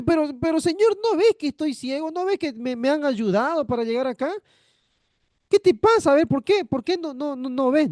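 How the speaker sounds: hammed-up 'can't you see I'm blind'; mock indignant blind man. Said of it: "el ciego podía haber dicho".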